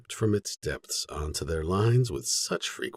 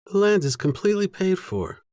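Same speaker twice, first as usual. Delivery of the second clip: pitched higher, a touch louder, matter-of-fact; lightly voiced Jenna; 11 semitones higher, 4 dB louder than usual. A narrator's reading. Jenna telling her brother the land is paid, lightly voiced higher. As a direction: give the sister's line a higher lift.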